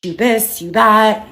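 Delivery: mock yelled insults from commenters; mock accusations. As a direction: throw the accusations back, exaggerated. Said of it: "You guys can sit here and be like".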